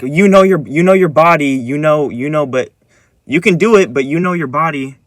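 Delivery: high, loud encouraging call, easing toward the end; friendly retold shout. Friendly guy shouting up to a scared dreamer, retold shout. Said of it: "someone was shouting from down the slope".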